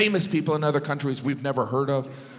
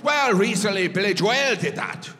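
higher-pitched mock Irish voice; Irish accent; 5 semitones higher than usual. Sound guy explains the problem to the comic, Irish accent. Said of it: "And he goes".

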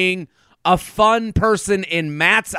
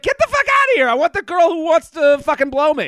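mock shout; 8 semitones higher, faster than usual. Voicing the bachelor dismissing the contestant, mock shout. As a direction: yell it in mock frustration. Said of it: "it's like".

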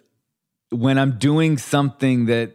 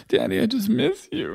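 daughter's voice, much higher; little daughter voice; 12 semitones higher than usual. Retold or imitated. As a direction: imitate a small girl missing her dad. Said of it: "when Rio's like".

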